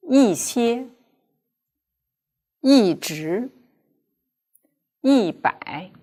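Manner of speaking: 一 falling sharply in 一些, 一直 and 一百; slow model with a falling 一 before each syllable; yi as fourth tone. Shown as a demonstration. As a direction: read it out for students to repeat. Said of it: "yi will be pronounced as fourth".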